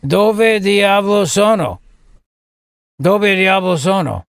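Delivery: slow, drawn-out mock Biden in Italian; Joe Biden. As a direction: stretch the Italian out like a befuddled president. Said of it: "you gotta slow it down for Biden".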